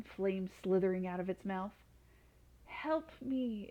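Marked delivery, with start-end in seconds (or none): 2.7-3.7 pleading. A narrator's reading. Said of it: "it pleaded".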